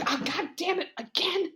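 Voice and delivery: high, mock-frustrated outburst; exasperated killer. Killer's flip-flop pops out repeatedly, high exasperation.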